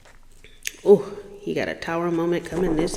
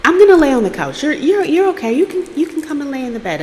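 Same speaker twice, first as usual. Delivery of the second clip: high, hurried insisting; retold polite protest; acted insistence; 8 semitones higher, faster than usual. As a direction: insist in a raised pitch, stumbling over repeats. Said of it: "And he insists".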